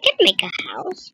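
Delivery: soft whisper. Real, not acted. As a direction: whisper it to yourself. Whispered aside while building.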